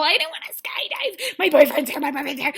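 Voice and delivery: imitating a clingy date; mock whiny girlfriend. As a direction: whine like a scared contestant. Voicing Victoria refusing skydive.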